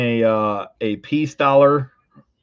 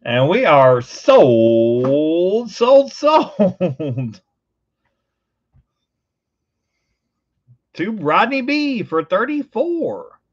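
drawn-out, sing-out closing call; triumphant auction close; auctioneer's sold call; 3 semitones higher, much slower than usual. Real, not acted.

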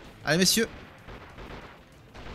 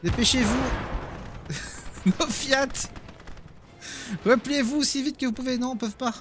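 frantic retreat order; 7 semitones higher than usual. Real, not acted. Panicked retreat under fire.

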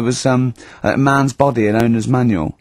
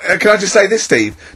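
normal talking voice

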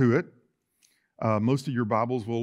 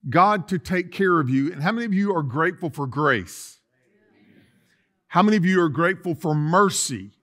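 louder, higher, building sermon cadence; full-force preaching; 5 semitones higher, 6 dB louder than usual. Acted. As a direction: preach it with rising fire. Preaching, voice rising.

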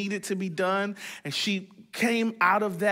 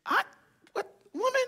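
retold disbelief; 11 semitones higher, 5 dB quieter than usual. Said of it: "and I'm like".

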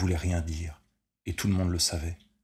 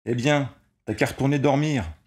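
loud, higher jeering yell; mocking shout; 7 semitones higher, 6 dB louder than usual. A narrator's reading. Ball player taunting the man at the window, mocking shout. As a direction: throw it back up at him, taunting. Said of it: "cria le blue jean".

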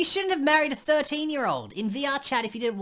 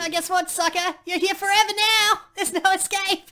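gleeful taunt; 6 semitones higher, 4 dB louder than usual. Acted. Gloating ghost taunting new arrival.

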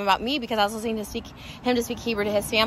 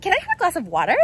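imitating his American accent; 6 semitones higher than usual. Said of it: "that's how he says it".

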